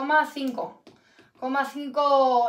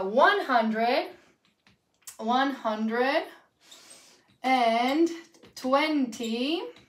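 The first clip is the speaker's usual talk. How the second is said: word by word; much slower than usual. Shown as a demonstration. Teacher dictating one hundred and twenty-five, piece by piece. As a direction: dictate in pieces with long pauses.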